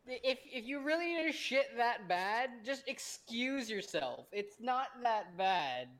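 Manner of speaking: high, slow, exasperated disbelief; can't believe anyone would do that; incredulous. Real, not acted. Incredulous rant about the meeting.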